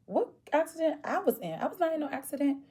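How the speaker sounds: can't believe what she's hearing; shocked disbelief. Retold or imitated. Insurance says she crashed.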